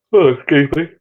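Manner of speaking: through a yawn. Real, not acted. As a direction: talk mid-yawn. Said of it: "I yawn, everyone yawns".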